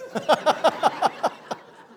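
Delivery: a burst of real, loud, voiceless laughter; loud breathy laughter. Real, not acted. Cousin's car broke down.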